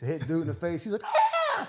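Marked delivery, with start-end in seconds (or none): 1.0-1.6 very high laughing scream; imitated scream. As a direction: shriek it mid-laugh.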